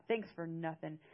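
plain reading voice

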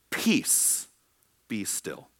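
hushed, slow command to the wind and waves. Retold or imitated. Jesus commanding the storm.

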